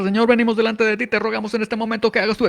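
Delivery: nonstop rapid prayer. Retold or imitated. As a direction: rattle it off without stopping for breath. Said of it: "sin parar".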